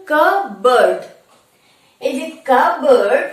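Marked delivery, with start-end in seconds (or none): 0.1-1.0 loud কাপবোর্ড as kuh-burd, p silent; correct pronunciation. Shown as a demonstration. Teacher recapping for students, correct pronunciation. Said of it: "আমরা কি বললাম?". 2.5-3.2 কাপবোর্ড as kuh-burd, p silent; correct pronunciation. Shown as a demonstration. Teacher modelling the word for students, correct pronunciation. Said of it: "পি is silent".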